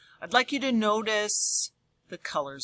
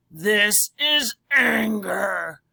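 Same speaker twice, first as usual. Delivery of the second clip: slow, loud angry shout; mock angry; 3 semitones higher, 7 dB louder, much slower than usual. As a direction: growl it out like anger itself. Page about anger.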